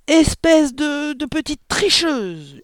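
high, loud, drawn-out roar of accusation; explode, stretching out the insult; sudden furious yell. A narrator's reading. Father pointing at his daughter, yelling furiously. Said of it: "hurla brusquement le père".

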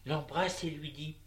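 ordinary delivery

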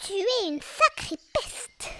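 high, slow, put-on tigress voice; ferocious tigress. A narrator's reading. Tigress pounces on Lulu, ferocious. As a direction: draw out the insult in a high, fierce voice. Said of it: "rugissement féroce".

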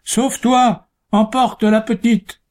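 desperate high shout. A narrator's reading. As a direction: yell it at her to flee. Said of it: "tout en criant à sa bûcheronne".

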